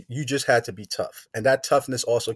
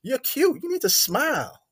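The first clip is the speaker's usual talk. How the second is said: higher, lightly voiced girl teasing; imitating his woman; 11 semitones higher than usual. Girlfriend telling tough guy to smile, higher playful mock voice. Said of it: "She like".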